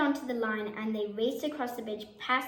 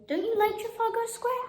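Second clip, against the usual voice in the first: put-on high little girl question; lightly voiced curious girl; child voice; 11 semitones higher than usual. A narrator's reading. Katie asks, child voice.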